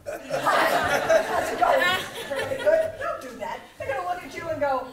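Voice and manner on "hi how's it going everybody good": loud wide-eyed hello with eyebrows up; teacher greeting class with startled eyebrows; mock startled greeting. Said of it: "Don't walk in and go".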